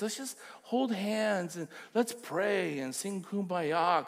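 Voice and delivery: high, placating mock calm-down voice; hammed-up pleading to calm down; mock soothing. Mocking someone soothing an angry Paul, high and placating. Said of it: "you wanna go to Paul and just say".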